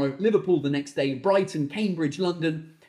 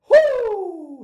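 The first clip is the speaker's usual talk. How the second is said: loud, high "woo" of release; high whoop; 14 semitones higher, 8 dB louder than usual. Real, not acted. Comedian venting after his rant, loud whoop. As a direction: let off steam with a whoop. Lets out tantrum's steam.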